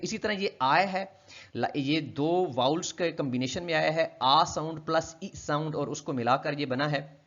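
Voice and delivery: usual speaking voice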